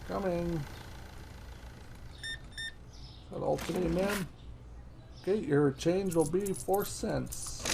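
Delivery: a hint of a cheerful shop clerk serving customers; polite cashier. Plays a polite game cashier.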